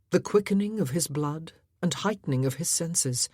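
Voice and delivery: ordinary delivery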